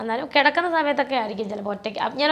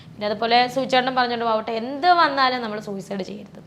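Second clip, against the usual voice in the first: ordinary talk, nothing marked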